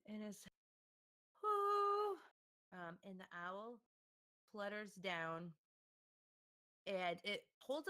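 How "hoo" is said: high loud owl hoot; shrill hooting owl call. Acted.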